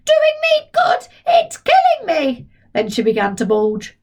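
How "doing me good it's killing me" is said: put-on very high screeching old woman; shouted. Grandma screams for the fire brigade, high screech. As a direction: screech it in panic.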